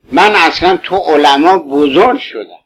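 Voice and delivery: usual speaking voice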